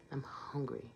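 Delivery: breathy whisper, no voice. Real, not acted. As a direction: whisper it for emphasis. Stressing how hungry she is.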